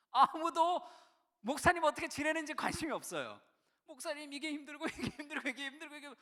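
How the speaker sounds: high, loud exasperated complaint; heated venting. Real, not acted. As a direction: pour out years of frustration. Venting about youth who only complain.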